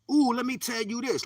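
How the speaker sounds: high, eager mock interrupter; intrusive voice. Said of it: "somebody else yelling at your head".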